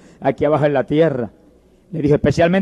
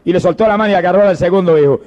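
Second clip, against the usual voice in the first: ordinary talk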